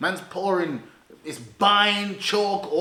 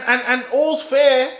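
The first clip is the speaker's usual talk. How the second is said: usual speaking voice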